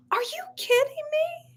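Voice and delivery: high, astonished question; real surprise at the news; shocked disbelief. Real, not acted. Shocked reaction to comment.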